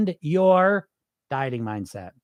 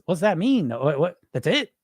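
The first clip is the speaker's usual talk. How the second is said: ordinary delivery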